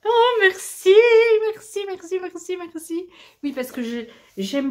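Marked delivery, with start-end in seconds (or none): 0.1-3.1 high gushing thank-yous; excited thanks. Real, not acted. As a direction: overflow with grateful joy. Thanking for the present.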